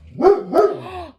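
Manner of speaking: high, loud sharp intake of breath; startled gasp. Real, not acted. Owner gasping at her dog, loud and startled. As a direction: gasp at what the dog did.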